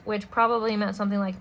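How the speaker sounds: ordinary delivery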